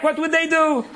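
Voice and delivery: plain reading voice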